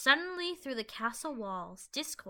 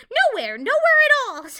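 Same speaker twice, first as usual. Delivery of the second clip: much higher, much louder flustered denial; nervous cover-up; 13 semitones higher, 19 dB louder than usual. A narrator's reading. Starlight covering her slip to Discord, flustered loud denial. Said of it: "trying to cover up what she had just said".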